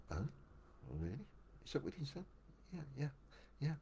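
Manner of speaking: hushed, taken aback murmur; a shaken man answering quietly; stunned quiet reply. Retold or imitated. Shocked guru quietly reacts.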